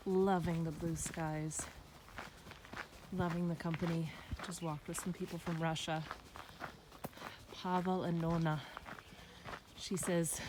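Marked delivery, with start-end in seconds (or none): none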